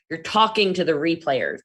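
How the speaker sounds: ordinary talk, nothing marked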